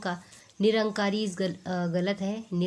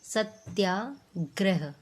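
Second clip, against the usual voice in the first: slow Satyagraha, split "sat-yaa ... grah"; syllable-by-syllable demo; much slower than usual. Shown as a demonstration. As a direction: pause inside Satyagraha before "grah". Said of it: "ठीक है".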